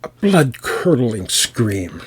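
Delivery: drawn out, ominous and heavy; slow dramatic emphasis. A narrator's reading. Narrator to listeners, savoring the horror slowly. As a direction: stretch every word for horror. Storyteller building to the scream.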